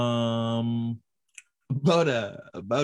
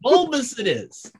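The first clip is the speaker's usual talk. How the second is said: higher, louder, slow proclamation; slow hammed-up verdict on the word; mock grand declaration; 12 semitones higher, 6 dB louder, slower than usual. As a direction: pronounce it like a royal decree.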